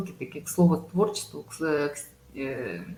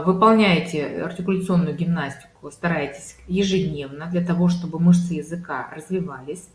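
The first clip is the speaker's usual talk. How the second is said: ordinary delivery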